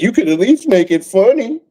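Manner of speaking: high, sneering put-on voice for a random viewer; mock snooty commenter. Imitating a random commenter, high and sneering. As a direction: pitch it way up and sneer like a heckler.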